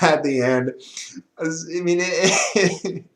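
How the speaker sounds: normal talking voice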